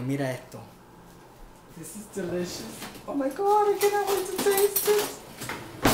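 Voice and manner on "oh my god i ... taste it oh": very high, loud delighted squeal; hammed-up diva gushing; excited squeal. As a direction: squeal with delight over the dish. Diva squealing over her food.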